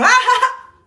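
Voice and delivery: loud, high mock laughter; dad's exaggerated laugh. Imitating her dad before his mood flips, exaggerated laugh. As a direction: burst into big put-on laughing. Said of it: "He could go from".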